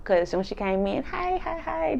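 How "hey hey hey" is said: high, light repeated hellos; mock greeting. Wendy coming into the room, mock greeting. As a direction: imitate a chirpy high-pitched entrance.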